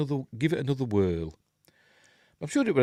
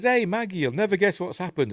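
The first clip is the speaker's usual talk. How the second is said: much higher, eager retold greeting; mock excited greeting; 10 semitones higher than usual. Alex greets Maggie with news, mock excited. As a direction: imitate someone bursting with news.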